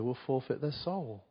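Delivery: ordinary delivery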